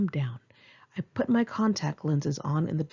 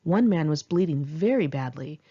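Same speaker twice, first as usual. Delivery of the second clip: ordinary delivery, nothing marked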